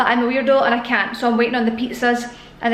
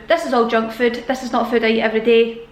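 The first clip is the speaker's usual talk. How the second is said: normal talking voice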